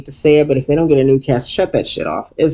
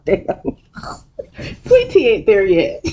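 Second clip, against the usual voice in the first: usual speaking voice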